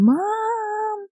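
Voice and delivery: high, long-held mock yell of "Mom"; imagined child yelling. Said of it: "into our house yelling".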